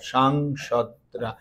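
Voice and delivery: সাংসদরা said slowly and softly, long সাং; correct form. Shown as a demonstration. Teacher drilling the class on সাংসদরা, slow and soft. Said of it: "বলতে হবে সাংসদরা".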